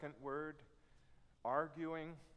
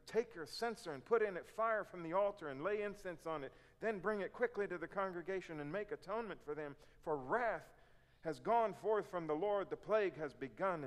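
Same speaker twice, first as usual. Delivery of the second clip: urgent command; 5 semitones higher, 5 dB louder than usual. Acted. Moses' urgent command read aloud.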